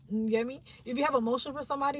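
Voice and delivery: ordinary delivery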